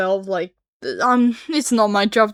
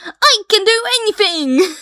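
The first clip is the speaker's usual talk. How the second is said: high, loud gleeful cry; excited shout; 11 semitones higher, 6 dB louder than usual. Retold or imitated. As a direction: shout it like pure triumph. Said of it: "someone once said".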